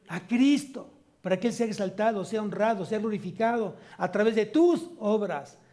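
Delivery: fervent preacher calling the church to glorify Christ; full-force preaching. Acted. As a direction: drive it home from the pulpit.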